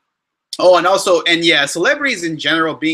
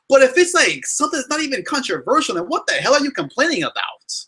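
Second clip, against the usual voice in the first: heated rant; 9 semitones higher than usual. Real, not acted. Ranting to viewers about dumb controversies, high exasperated rant. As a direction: pitch climbs high in exasperation.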